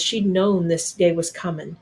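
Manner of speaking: usual speaking voice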